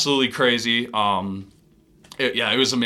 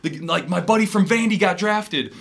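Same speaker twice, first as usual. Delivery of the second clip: mock excited screaming; 8 semitones higher than usual. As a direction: imitate teammates crying out the news. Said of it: "they're kinda screaming out".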